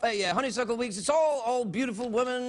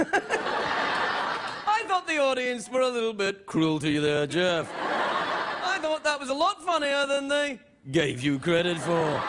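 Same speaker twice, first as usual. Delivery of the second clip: English TV presenter; 3 semitones higher, slower than usual. Acted. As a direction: draw it out, then sink to sentence end. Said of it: "talk like an English television presenter".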